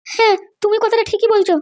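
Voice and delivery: high, slow woman's voice; slow, high-pitched agreement; mother's voice. Acted.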